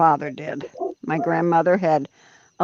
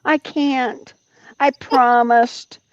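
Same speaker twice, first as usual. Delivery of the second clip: teasing; 6 semitones higher, much slower than usual. Pretend. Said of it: "Why are you teasing?".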